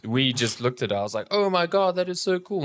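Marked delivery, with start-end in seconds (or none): 1.3-2.6 high-pitched recalled awe; gush, reliving the wow moment; retold amazement. Guest retells first seeing the wedding cake, amazed.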